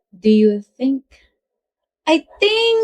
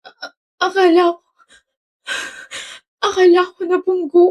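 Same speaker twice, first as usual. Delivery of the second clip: tearful, halting, pitched up high; crying; 6 semitones higher than usual. Acted. Girl tells Rave she thought he'd been hit, crying. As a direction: stammer it out through tears. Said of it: "Bakit ka umiiyak?".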